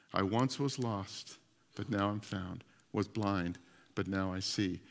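low, soft hymn recitation; hushed reverence. Retold or imitated. Preacher quoting the rescued captain's hymn, hushed reverence. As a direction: recite it softly, line by line.

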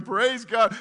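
high, full-voiced shout of praise; yelling. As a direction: yell it out like an overwhelmed witness. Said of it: "She's yelling".

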